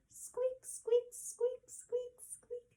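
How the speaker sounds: high squeaky mouse voice; mouse squeaks. Shown as a demonstration. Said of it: "little mice say".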